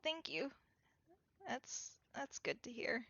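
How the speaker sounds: soft, halting gratitude; quiet thanks. Acted. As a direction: give thanks softly, in character. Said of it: "Fleck just nods, like".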